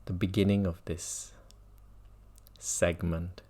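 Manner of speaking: ordinary delivery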